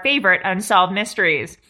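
ordinary talk, nothing marked

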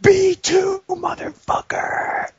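high, hammed-up kid shrieking; overexcited kid. Nineties ad kid winning at Battleship, high overexcited squeal. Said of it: "way too excited to play Battleship".

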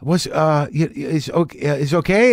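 put-on hesitant worker in a vague foreign accent; Armenian-or-Italian style foreign accent, halting; broad foreigner accent. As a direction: fumble for English words in a generic foreign accent. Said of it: "a broad-based foreigner accent".

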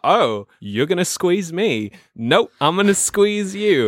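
high, slow, drawn-out taunt; Christopher Walken impression. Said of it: "He was like".

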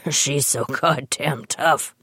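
imitating a choked-up, proud grandpa, slow; mock the old man overcome with pride; a hint of a tearful, proud grandfather, slow. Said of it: "wiping tears of pride from his eyes".

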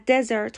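"دزرت" said DE-zert; stress on first syllable. Shown as a demonstration. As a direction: hit the start of "دزرت", DE-zert. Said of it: "استرس روی سیلاب اوله".

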